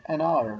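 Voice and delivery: "एन आर" said loud, with the H silent; "एन आर" starting hour on its vowel, no H. Shown as a demonstration. Teacher showing why hour takes an, "एन आर" with silent H. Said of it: "हम pronounce करते हैं इसको ओ से".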